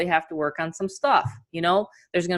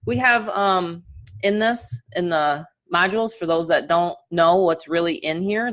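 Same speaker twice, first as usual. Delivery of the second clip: ordinary talk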